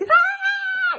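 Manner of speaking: high, loud imitated tantrum scream; mock toddler shriek; child's scream. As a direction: shriek like a kid throwing a fit. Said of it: "they may be like".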